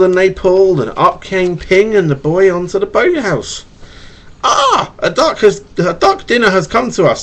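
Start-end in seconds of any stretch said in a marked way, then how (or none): 4.4-7.2 father's delight. Acted. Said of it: "said the boy's father".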